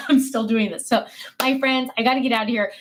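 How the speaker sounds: normal talking voice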